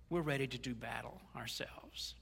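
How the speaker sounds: hushed, voiceless whisper. Real, not acted. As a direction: lean in and breathe it with no voice. Sermon drops to hushed intensity.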